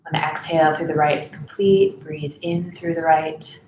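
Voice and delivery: even, softening voice with space to breathe; guided meditation. Real, not acted. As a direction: guide them unhurriedly through each breath.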